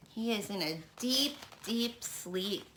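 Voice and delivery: soft, hushed telling. A narrator's reading. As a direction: hush so not to wake him. Storyteller hushes at sleeping dragon.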